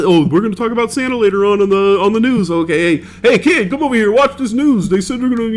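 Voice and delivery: a hint of a parent hyping up the Santa news; excited parent. Parents voiced fooling the kid.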